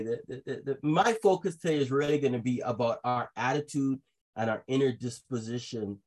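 ordinary talk, nothing marked

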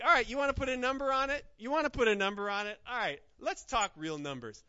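playful Jesus. Retold or imitated. Lightly voiced Jesus teasing Peter about counting, playful. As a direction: toss off the number like a challenge. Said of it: "Jesus is like".

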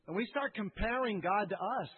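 ordinary talk

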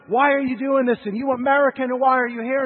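loud, high accusing yell; retold crowd yelling. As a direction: shout it like an angry mob. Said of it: "people start yelling at me".

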